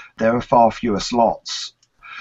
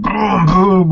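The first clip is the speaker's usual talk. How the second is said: drawn-out mock engine roar, swelling louder; playful vroom-vroom noise; imitated engine noise; 7 semitones higher, 6 dB louder than usual. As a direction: go "brum, brum" like a revving car. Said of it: "I just kind of went sort of".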